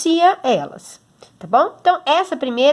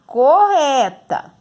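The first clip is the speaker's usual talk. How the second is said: correta drawn out slow and louder, co-rre-ta; slow and stressed; 4 semitones higher, 5 dB louder, much slower than usual. Shown as a demonstration. Teacher stressing the key word for pupils, slow. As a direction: lean on correta slowly. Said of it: "pronunciem as palavras".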